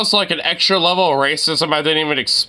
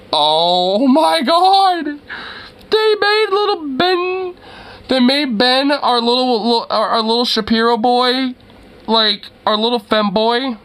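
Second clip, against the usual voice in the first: excited disbelief; 6 semitones higher than usual. Real, not acted. Mocking Ben Shapiro cameo.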